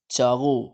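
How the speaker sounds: چاغو said loud as "chaa-GHOO"; Persian gh sound. Shown as a demonstration. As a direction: model چاغو firmly, gh replacing q. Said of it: "وہ قاف کو غین بولتے ہیں". (چاغو، قاف کو غین بول کر، بلند آواز میں)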